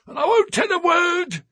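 high, loud cracked refusal; old man's shout. A narrator's reading. Old sailor shouts his refusal at the two men. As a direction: slam the door on them with your voice.

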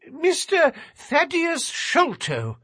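high, thin nervous little man. A narrator's reading. Narrator voices Thaddeus.

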